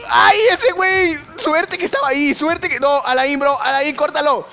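high, urgent yelling to a teammate; real panic in the match; alarmed shouting. Real, not acted. Danger, calls to teammate.

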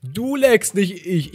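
high, loud, drawn-out words; indignant call-out. Real, not acted. Gamer protesting to opponent during a fight, loud indignation.

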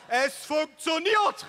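very high, loud, slow cry of triumph; elated shout. Acted. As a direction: crow with delight. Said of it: "rief mein Vater begeistert". (begeisterter, hoher Jubelschrei)

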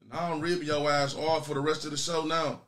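ordinary delivery

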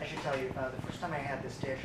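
low, soft, confiding aside; hushed confession. Acted. Server admitting a stomach mishap to diners, hushed. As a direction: drop your voice to admit it discreetly.

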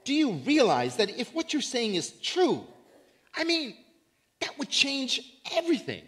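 as a stunned listener grasping the news, voice rising; awed Greek audience. Retold or imitated. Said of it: "John's Greek audience would be saying".

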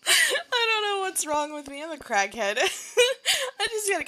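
high and loud, voice cracking with laughter; giddy laughing. Real, not acted. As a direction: lose it laughing at yourself.

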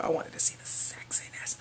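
hushed cheeky whisper. Real, not acted. Fan whispering a cheeky confession. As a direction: confide it under your breath.

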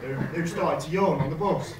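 ordinary talk

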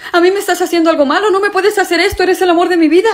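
high, fast, pleading protest; desperate pleading. Acted. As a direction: beg her not to leave you. Boyfriend pleading against breakup.

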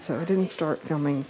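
ordinary delivery

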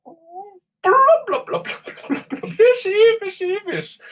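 very high squeaky gibberish; silly squeaky fish. Acted. Playing the fish goofily.

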